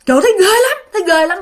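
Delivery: put-on high alarmed child's voice; frightened exclamation. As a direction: blurt it out in fright. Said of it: "sợ quá mà các bạn".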